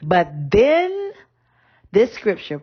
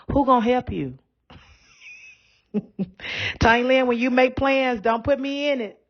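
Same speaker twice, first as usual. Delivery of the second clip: higher-pitched doubting grandma, lightly put on; grandmother's voice; 5 semitones higher than usual. Grandma questioning her granddaughter's plans, mock doubtful voice. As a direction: imitate grandma scoffing at big plans. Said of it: "here go my grandmother' favorite one".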